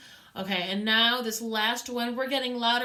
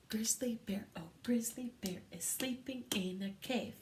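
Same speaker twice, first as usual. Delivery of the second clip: soft hushed whisper; chanting the line in a whisper for the class; piano whisper; 7 dB quieter than usual. Shown as a demonstration. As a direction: whisper it so the bear sleeps on. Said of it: "Let's speak the first line, piano, softly".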